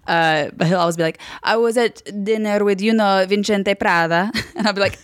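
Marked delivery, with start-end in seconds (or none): 1.4-4.3 name-dropping Italian, pitched a bit higher; Italian-accented fashion insider. Retold or imitated. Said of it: "an impression of him".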